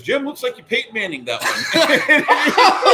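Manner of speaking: high, loud, slow, laughing; mock announcer through laughter. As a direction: say it while cracking up. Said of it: "the announcer goes".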